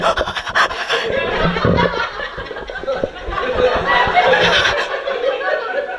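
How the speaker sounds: long, high, breathy telenovela gasp; milk the gasp like a soap diva; dramatic gasp. Retold or imitated. Comedian acting out the endless soap opera gasp, long and high. Soap heroine mocked again.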